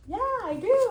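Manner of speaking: high-pitched enthusiastic agreement; expressing a yes. Acted. Child answering mom in a store, high keen yes. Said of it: "you wanna express".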